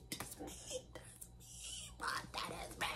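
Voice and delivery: whispered, awed "that's me"; breathy whisper. Retold or imitated. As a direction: hush it like you can't believe it. Whispered repeats of realization.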